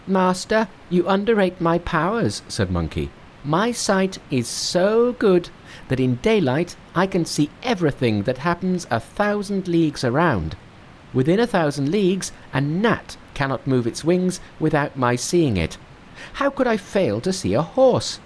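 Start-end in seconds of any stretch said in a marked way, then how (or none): 0.1-2.4 high, bright-pitched monkey king. Acted. Narrator voicing Monkey to his master, high monkey voice. 3.4-18.2 high, bright-pitched monkey king. Acted. Narrator voicing Monkey to his master, high monkey voice.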